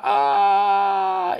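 long, loud, high mock scream; flying scream. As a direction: scream like a cat hurled through the air. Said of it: "y Floyd grita". (grito largo y agudo al volar)

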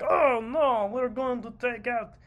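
high, loud mock Russian accent, hammed up. Mocking Russia's excuses in a hammed-up Russian accent. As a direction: put on a cartoon Russian accent. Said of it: "is this a Russian accent?".

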